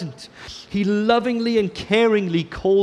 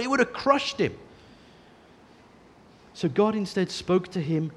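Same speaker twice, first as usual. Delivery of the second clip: normal talking voice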